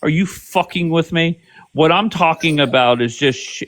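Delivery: ordinary delivery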